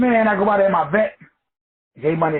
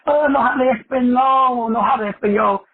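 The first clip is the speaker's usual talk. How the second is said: amused protest; 6 semitones higher than usual. Retold or imitated. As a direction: plead no Spanish in a raised, amused voice. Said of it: "I just started laughing, saying".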